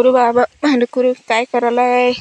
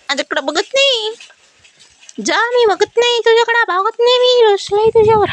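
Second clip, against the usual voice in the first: high, sulky little voice; sulking pet voice; 11 semitones higher than usual. Pretend. Owner voicing her sulking pet, high pouting voice. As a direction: pout and refuse to look, high-pitched.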